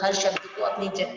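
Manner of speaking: usual speaking voice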